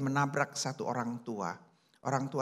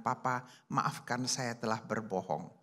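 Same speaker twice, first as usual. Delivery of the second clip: quiet tearful apology; 6 semitones lower, 6 dB quieter than usual. A narrator's reading. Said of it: "dan dia menangis".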